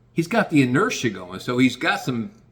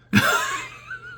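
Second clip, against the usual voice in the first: high burst of gleeful laughter; delighted high laugh at his own mischief; real laugh. Real, not acted. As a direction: cackle at how well the stirring worked.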